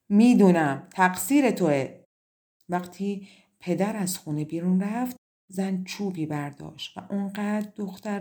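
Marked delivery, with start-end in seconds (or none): 0.1-1.9 much louder, accusing yell; furious shout. A narrator's reading. Stepmother shouting at her stepdaughter in fury. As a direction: blame her in a rage. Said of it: "خشمگین ... فریاد زد".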